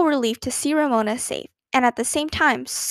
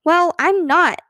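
louder, higher irritated retort; snapping; 5 semitones higher, 5 dB louder, slower than usual. A narrator's reading. Older sister refusing her sister's game, snapping. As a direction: snap back at a little sister. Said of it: "snapped Beezus".

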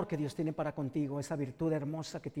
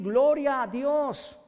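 drawn-out, louder exultant praise; slow joyful praise; 7 semitones higher, 7 dB louder, much slower than usual. Acted. Healed blind man shouts praise, slow and exultant. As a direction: stretch the praise out in joy.

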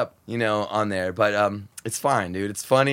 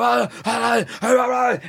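high mock yells of panic; mock chaotic shouting backstage; imitated backstage yelling; 9 semitones higher than usual. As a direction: sound like frantic crew. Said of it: "everybody's fucking yelling and freaking out".

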